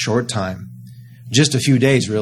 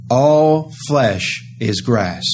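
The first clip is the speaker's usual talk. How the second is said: very slow, weighty, each word laid down; solemn slow pronouncement; much slower than usual. Acted. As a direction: let the verdict fall slowly, word by word. The voice answers the prophet.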